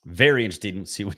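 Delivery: ordinary talk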